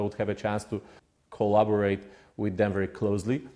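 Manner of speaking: usual speaking voice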